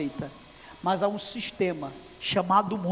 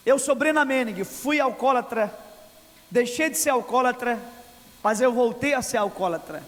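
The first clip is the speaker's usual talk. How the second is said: loud, higher declaring voice for the author; preached quote; 4 semitones higher, 4 dB louder than usual. Retold or imitated. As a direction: declaim the confession like a testimony. Preacher voicing Brennan Manning's book. (citação pregada em voz alta)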